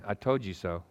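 preacher repeating the imagined scolding; quiet smug taunt. Retold or imitated. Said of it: "here's what you think God's saying".

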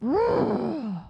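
long, high grudging groan; exasperated sigh. Retold or imitated. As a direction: heave a mock-exasperated sigh. Retold grudging reaction to God.

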